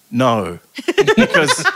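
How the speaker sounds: a real laugh breaking through the no. Real, not acted. Recalling the client's request, laughing no. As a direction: laugh it off as the idea sinks in.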